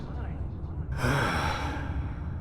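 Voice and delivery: long soft unvoiced sigh; drained exhale; weary sigh. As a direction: let out a tired dying breath. Dying man sighs.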